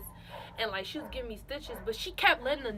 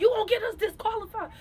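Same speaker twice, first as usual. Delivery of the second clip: coach yelling; 10 semitones higher, 6 dB louder, faster than usual. Retold or imitated. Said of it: "she was like".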